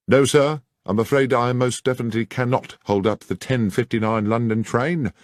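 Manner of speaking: lightly voiced stubborn stationmaster; firm station master. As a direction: politely refuse the detective. Station master refuses Holmes.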